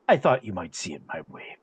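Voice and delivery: a low, measured in-character reply; lightly voiced character. Game master answering as a character at the meeting, lightly voiced and low. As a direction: drop the pitch for a hint of a role.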